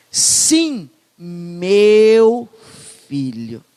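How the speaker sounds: "Sim, meu filho" lingering on "meu"; loving father's reply; tender father. Shown as a demonstration. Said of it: "é uma frasezinha".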